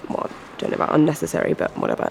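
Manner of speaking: under-the-breath grumble, voiceless and low; muttered aside. Real, not acted. Muttering to himself while on the phone with Jean-Marc. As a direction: mutter it so the caller can't hear.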